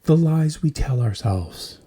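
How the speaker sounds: ordinary talk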